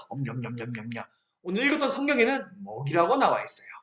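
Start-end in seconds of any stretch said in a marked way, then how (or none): 0.1-1.0 soft chomping mouth sounds; playful nom-nom eating noise; mock gobbling up the giants; munching noise. Mimics eating the enemy.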